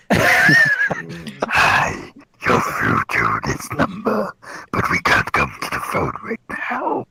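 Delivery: answering machine greeting voice; telephone voice. Pretend. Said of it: "[name]' telephone voice".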